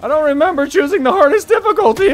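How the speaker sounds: high-pitched, flustered complaint; exasperated protest. Real, not acted. Protesting the hard difficulty.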